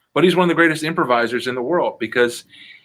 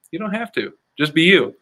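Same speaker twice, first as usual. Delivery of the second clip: normal talking voice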